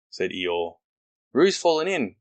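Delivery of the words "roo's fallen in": higher, louder alarmed cry; cried out. Acted. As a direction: call out the alarm as Rabbit. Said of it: "cried Rabbit".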